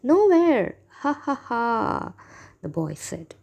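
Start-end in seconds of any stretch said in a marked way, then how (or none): 0.0-0.8 high, loud mocking answer; singled-out, teasing exclamation; gleeful taunt. A narrator's reading. Boy taunts the villagers. 1.0-2.1 slow, drawn-out ha-ha-ha; acted prankster's laugh; mocking laugh. A narrator's reading. Boy laughs at villagers.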